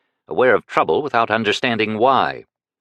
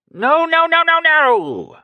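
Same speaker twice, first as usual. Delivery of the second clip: high, loud frantic no; old beggar screaming to correct his bid; screamed; 5 dB louder, slower than usual. A narrator's reading. Screaming protest. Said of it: "Baslam screamed".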